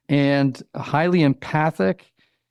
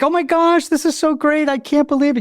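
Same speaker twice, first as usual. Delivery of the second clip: much higher, excited squeal of joy; gushing delight; 12 semitones higher than usual. Pretend. Wife opening a gift from a friend, gushing excitedly. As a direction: gush over the gift like a thrilled child. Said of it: "you'd be like".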